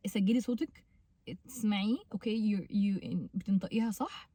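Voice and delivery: usual speaking voice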